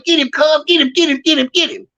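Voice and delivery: high, urgent egging on; urging on. Retold or imitated. Telling Crazy Ray to chase the fool down, urgent and high. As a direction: push your partner to finish it.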